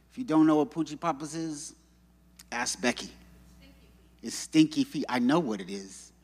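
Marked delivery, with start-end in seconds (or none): none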